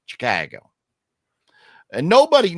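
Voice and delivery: usual speaking voice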